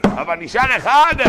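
slow, loud and high climax; triumphant cry. Acted. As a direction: proclaim it slowly, full voice. Preaching climax, the one jug. (קריאת ניצחון איטית ורמה)

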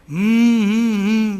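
long loud machine-like "mmm"; imitated droning hum. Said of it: "מכשירים דוממים". (זמזום ממושך כמו מכונה)

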